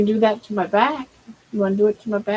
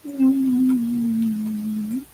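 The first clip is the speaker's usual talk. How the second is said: ordinary delivery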